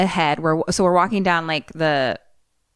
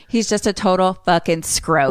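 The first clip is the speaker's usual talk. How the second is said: ordinary delivery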